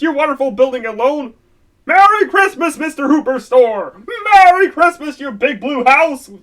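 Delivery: ordinary talk, nothing marked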